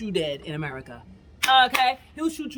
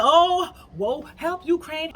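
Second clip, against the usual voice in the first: higher, louder mock plea; higher mock outcry from everybody about Ukraine; 8 semitones higher, 6 dB louder, slower than usual. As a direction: parody the crowd crying help Ukraine. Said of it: "Everybody be like".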